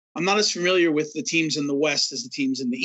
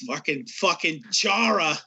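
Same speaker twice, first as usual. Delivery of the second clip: slow, drawn-out Boston-fan grumble, a hint of accent; mock Boston fan accent; slower than usual. Shown as a demonstration. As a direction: drag out the names like a sore Boston fan. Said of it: "I can't really do a Boston accent".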